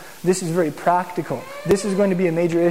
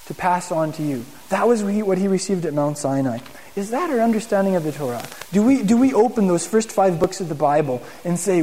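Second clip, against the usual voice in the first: normal talking voice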